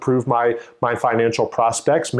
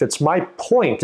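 usual speaking voice

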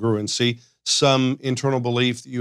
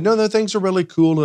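higher-pitched fake reassurance; mock breezy denial, pitched up; pretending all's fine; 7 semitones higher, 3 dB louder than usual. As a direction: imitate someone covering up. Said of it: "you're pretending that".

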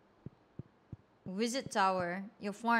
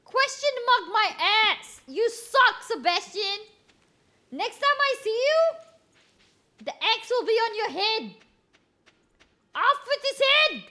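furious yelling, much higher and louder; angry high-pitched; 11 semitones higher, 8 dB louder than usual. Real, not acted. Streamer raging at Sebastian, high furious shouting. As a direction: scream it at him, voice shooting up in rage. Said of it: "My voice gets high-pitched when I'm angry".

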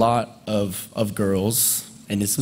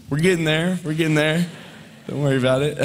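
mock reassurance; 7 semitones higher than usual. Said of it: "And I'd be like".

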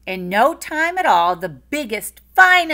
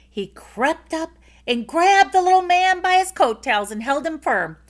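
usual speaking voice

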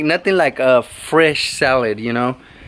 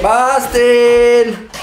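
higher, long drawn-out name call; calling the dog; 5 semitones higher than usual. Real, not acted.

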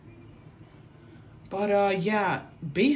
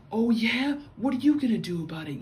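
taunting Karen; 7 semitones higher, 3 dB louder than usual. Retold or imitated.

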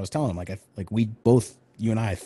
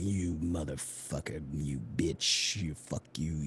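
low, snarling mock villain curses; maniacal villain; 7 semitones lower, 10 dB quieter, slower than usual. Villain spitting insults at Lily, low snarl. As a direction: growl it like a cartoon bad guy. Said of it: "maniacal and fucking straight up".

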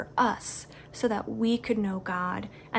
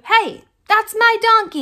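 higher, louder indignant owner; protesting owner; 10 semitones higher, 9 dB louder than usual. Acted. Donkey owner confronting the disciples, loud indignant call.

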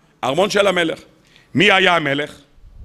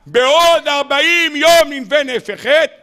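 drawn-out prophet's call, slow; slow proclaiming cry; 5 semitones higher than usual. Retold or imitated. Rabbi acting the prophet calling in the market, slow cry. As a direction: call the warning slowly across the market. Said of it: "עמד במקום אחד וצעק".

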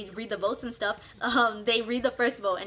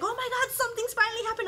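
high-pitched imitated thrill; excited; 11 semitones higher, faster than usual. Waiting castaways spotting boats coming, imitated high excitement. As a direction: mimic bored castaways suddenly thrilled, voice way up.

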